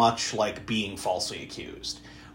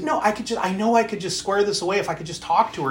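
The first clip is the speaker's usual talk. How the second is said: higher, protesting voice of a client; insistent pleading; 6 semitones higher than usual. Retold or imitated. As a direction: argue back, pitch lifted, pleading to be allowed. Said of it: "And he's like".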